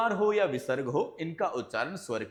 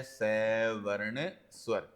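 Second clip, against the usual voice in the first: slow key term; 5 semitones lower, 4 dB quieter, much slower than usual. Shown as a demonstration. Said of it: "teacher stresses the key term".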